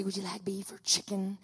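soft whispered stewardess question; whispered offer. Retold or imitated.

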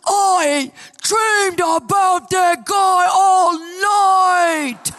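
high, loud, slow anguished shout; husband waking up and yelling, furious and loud; furious wail. Retold or imitated. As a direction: groan it out like a tormented man. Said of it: "he goes".

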